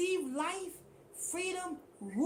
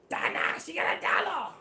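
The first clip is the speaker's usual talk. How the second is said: imitating Donox. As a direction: croak out the counts like a broke man on the corner. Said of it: "That's what he said".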